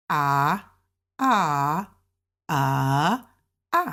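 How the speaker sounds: long low A sounds swooping, then one short drop; four tones in turn. Shown as a demonstration. Teacher recaps the tones for learners, A tone by tone. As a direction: run A, said ah, through the tones. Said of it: "important to understand the first tone".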